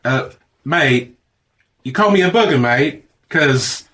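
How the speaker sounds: confused Australian. Acted. Space Baby Aussie puzzled reply.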